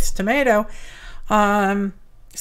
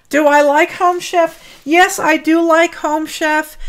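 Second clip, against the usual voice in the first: upbeat; 6 semitones higher, 5 dB louder than usual. Real, not acted. Answering a viewer's question.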